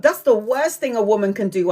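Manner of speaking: ordinary delivery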